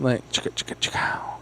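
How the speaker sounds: whispered. Acted. Whispered sound effect.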